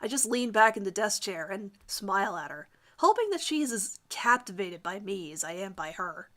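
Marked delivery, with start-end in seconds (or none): none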